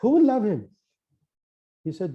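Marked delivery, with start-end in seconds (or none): 0.0-0.7 retold upset wife. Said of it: "the wife gets upset".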